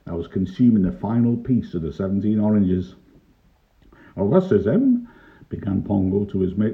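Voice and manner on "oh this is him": high-pitched announcement; policeman presenting culprit. A narrator's reading. Pongo begins to his mate, high and officious.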